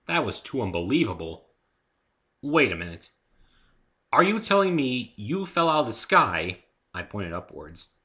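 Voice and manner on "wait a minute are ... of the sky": loud, rising disbelief; can't believe what he hears; incredulous. Acted. Dave questions Fluttershy, loud and incredulous.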